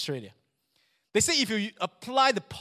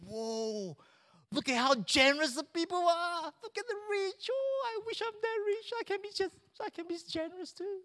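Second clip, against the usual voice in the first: high-pitched, softer awestruck onlooker; changed crowd voice; 11 semitones higher, 3 dB quieter than usual. Acted. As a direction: put on a very high voice of wistful admiration. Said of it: "I changed my voice then".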